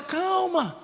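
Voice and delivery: a quiet soothing word; calm reassurance. Retold or imitated. Eliseu calms his panicking servant, soft and quiet. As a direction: softly say calma to steady him. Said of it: "Ele fala".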